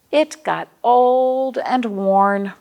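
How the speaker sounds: put-on old and worn, drawn out and emphatic; slow storybook refrain. A narrator's reading. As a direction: stretch each word out for the kids. Refrain read to children.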